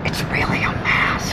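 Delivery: hushed secret whisper. Pretend. Secret confided about the mask.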